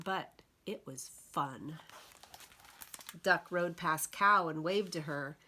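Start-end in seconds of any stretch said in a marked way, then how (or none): none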